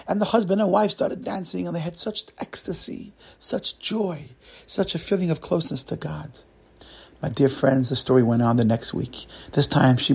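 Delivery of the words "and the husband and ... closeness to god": awed joy. A narrator's reading. Narrating the couple's ecstasy.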